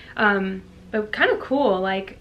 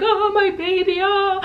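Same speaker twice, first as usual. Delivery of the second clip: mock teary mom; 10 semitones higher than usual. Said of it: "I thought I'd be crying".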